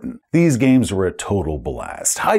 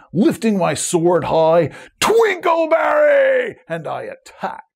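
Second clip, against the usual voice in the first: battle cry; 11 semitones higher, slower than usual. Acted. Cameraman charging zombies, hammed-up high battle cry. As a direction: roar the sword's name into battle.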